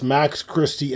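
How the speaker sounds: ordinary talk, nothing marked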